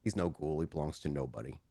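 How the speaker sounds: low, hushed correction; soft, low official voice; low-key lawman, firm. Acted. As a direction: state it quietly, pitched well down.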